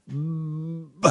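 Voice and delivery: B buzzed softly until the air runs out; B running out. Shown as a demonstration. Teacher showing the voicing ending, soft. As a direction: let the B hum fade out. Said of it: "épuisé la vibration".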